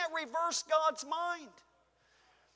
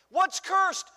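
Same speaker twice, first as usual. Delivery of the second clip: preacher's call; 5 semitones higher, 5 dB louder than usual. Real, not acted. Preacher calls out to congregation.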